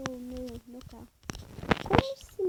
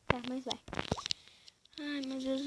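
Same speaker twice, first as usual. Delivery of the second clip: ordinary talk, nothing marked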